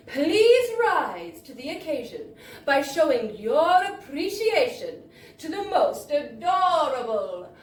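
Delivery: loud, grand, slowed ringmaster build-up; ringmaster introduction. Retold or imitated. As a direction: announce like an old circus ringmaster. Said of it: "went something like this".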